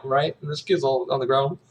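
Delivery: usual speaking voice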